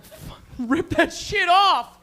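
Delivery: high, loud, slow yell; acted outburst, stretched for emphasis; mock aggressive retort. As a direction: draw it out into a hammed-up shout. Acts out retort to girlfriend.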